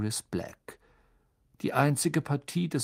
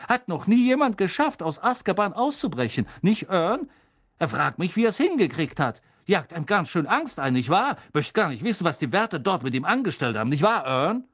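high, loud relishing gossip; Stan; 4 semitones higher, 4 dB louder than usual. Acted. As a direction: spook the listener with glee.

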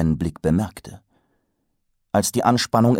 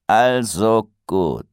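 grumbling; 3 semitones higher, 5 dB louder, much slower than usual. A narrator's reading. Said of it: "brummte der oberste größte Meister".

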